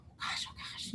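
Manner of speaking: whispered anxious prayer to oneself; hushed fretting. Retold or imitated. Mother alone after hanging up the phone, hushed fretting. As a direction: fret under your breath, barely voiced.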